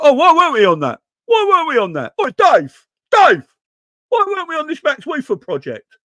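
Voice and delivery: high, loud whining fan, pleading with Dave; whiny fan. Retold or imitated. Fan pesters his mate Dave about the missed signing, whining high and loud. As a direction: whine it like a fan who missed out.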